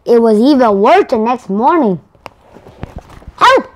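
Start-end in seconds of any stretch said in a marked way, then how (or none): 3.4-3.7 high panicked yell; yelling for help. Acted. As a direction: cry out for help in fright. Arthur wakes to scare.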